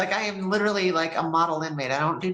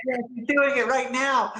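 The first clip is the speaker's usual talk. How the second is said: much higher, thrilled cry; excited; 8 semitones higher than usual. Retold or imitated. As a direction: blurt it out with joy. Excited at noon in cell.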